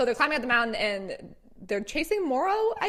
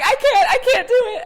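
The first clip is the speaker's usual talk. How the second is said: panicked wail; 12 semitones higher, 12 dB louder than usual. Retold or imitated. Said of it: "And then Cole's like".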